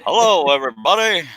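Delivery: laughing mock announcer greeting; impression of Wes. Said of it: "says you're good at doing Wes".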